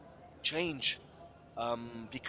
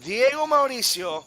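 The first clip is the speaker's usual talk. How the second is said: higher, louder, mounting commentary; tense build-up; 7 semitones higher, 5 dB louder than usual. Real, not acted. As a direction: build tension before the kick. Build-up to penalty.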